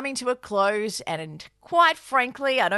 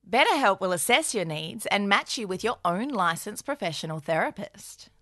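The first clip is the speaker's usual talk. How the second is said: ad read; 4 semitones lower than usual. Acted. BetterHelp ad read by both.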